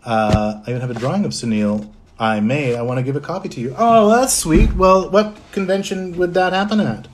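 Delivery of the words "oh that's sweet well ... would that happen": high, loud, gushing thanks; touched delight. Real, not acted. Streamer thanking fan for a drawing, touched and delighted. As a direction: gush at a sweet offer, pitched right up.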